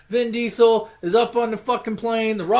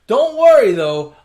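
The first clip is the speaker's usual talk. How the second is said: higher and louder, cocky; mock tough shout; 4 semitones higher, 8 dB louder, slower than usual. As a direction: reassure him with a swagger. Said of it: "he's like".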